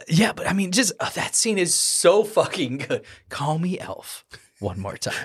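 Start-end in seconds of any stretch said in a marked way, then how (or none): 3.3-5.1 hushed movie-quote warning; quiet threat. Acted. Requotes the threat more quietly.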